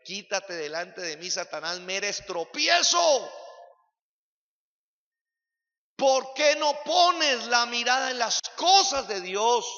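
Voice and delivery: slow and loud, as Jesus casting out Satan; preacher booming Jesus' rebuke. Retold or imitated. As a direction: thunder it out at Pedro. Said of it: "Jesús dijo claramente".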